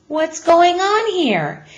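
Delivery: high, loud and slow cheerful call; called out cheerfully. A narrator's reading. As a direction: sing it out brightly from under the board. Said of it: "he called out cheerfully".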